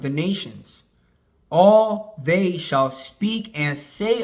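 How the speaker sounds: slow, emphatic Bible reading; scripture reading. Acted. Preacher rereads the prophecy to listeners, slow emphatic scripture reading. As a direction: draw out each phrase of the verse with weight.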